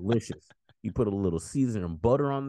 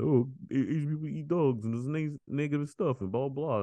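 higher-pitched mocking quote of others; pitched-up dismissive imitation; imitating rude remarks; 7 semitones higher than usual. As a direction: parrot ignorant gossip, pitched up. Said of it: "why you guys say it so rudely".

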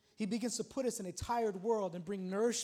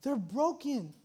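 normal talking voice

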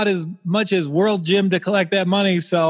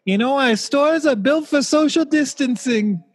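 much higher, nasally Midwest mom voice; 9 semitones higher than usual. Retold or imitated. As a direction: play a suburban Midwest mom reassuring shoppers. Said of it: "hear that in a mom's nasally Midwest voice".

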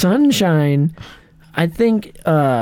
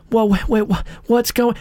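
flustered reading; 6 semitones higher than usual. Shown as a demonstration. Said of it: "like, start it".

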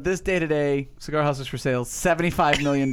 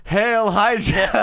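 villain salute; 7 semitones higher, 7 dB louder, much slower than usual. Pretend.

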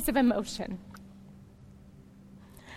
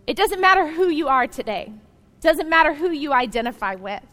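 preaching loudly; 3 semitones higher, 5 dB louder than usual. Acted. Preacher addresses all listeners.

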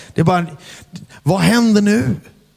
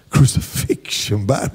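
baffled echo; slower than usual. Retold or imitated. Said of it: "ni är The Crucifixion Band ikväll".

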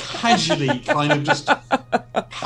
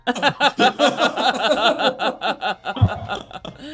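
laughing; 6 semitones higher, 5 dB louder, slower than usual. Acted.

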